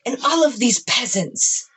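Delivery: lightly voiced haughty queen; a hint of a regal ruler decreeing; dark lord. Mom as queen on throne.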